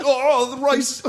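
high, loud put-on voice; mock voice. Mocking himself as a drunk gremlin, high squeal. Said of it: "I'm just like".

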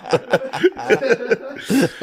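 long high-pitched laugh. Real, not acted. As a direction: cackle at the punchline. Cracking up after the story.

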